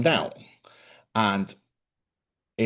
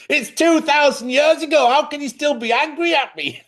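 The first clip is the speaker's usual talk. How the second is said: much higher, louder, hammed-up whiny voice; mock exasperated plea; 6 dB louder than usual. To the woman from a Roman lifetime, a high, hammed-up whine. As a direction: squeal it like a put-upon victim.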